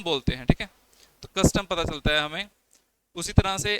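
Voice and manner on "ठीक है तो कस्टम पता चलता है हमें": ordinary talk, nothing marked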